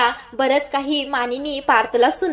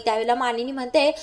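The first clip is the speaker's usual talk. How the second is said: usual speaking voice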